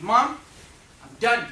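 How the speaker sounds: put-on loud, high declaration of a boy quitting school; defiant kid. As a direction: announce it like a stubborn seven-year-old. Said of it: "I said".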